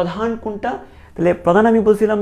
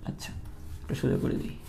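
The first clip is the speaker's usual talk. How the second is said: whispered aside; 11 dB quieter than usual. Real, not acted. Whispered aside while drawing.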